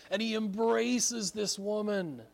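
ordinary delivery

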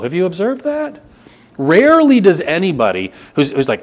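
ordinary delivery

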